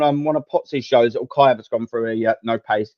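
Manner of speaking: ordinary delivery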